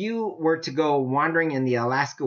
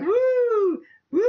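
very high, gleeful whooping with laughter; joyful whoop; 3 dB louder than usual. Retold or imitated. Preacher voicing a healed man leaping in heaven, high gleeful whoop.

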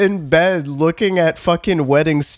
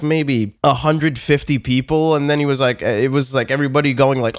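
normal talking voice

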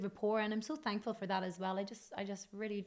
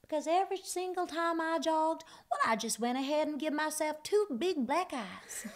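sweet Tennessee twang deadpan; 7 semitones higher, 5 dB louder than usual. Retold or imitated. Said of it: "She was like".

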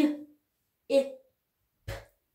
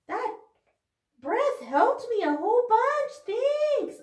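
much higher, louder puppet talk; 9 semitones higher, 4 dB louder than usual. Pretend. Puppet dog thanking the kids for helping, high and cheerful. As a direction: chirp your thanks as the little dog.

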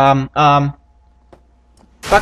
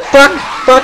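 ordinary delivery

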